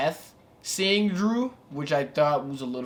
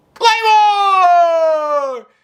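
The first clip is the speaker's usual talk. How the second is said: yelled out high and very loud; excited shout; 15 dB louder than usual. Real, not acted. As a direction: scream the move name like a hyped fan. Hyping a surprise wrestling return.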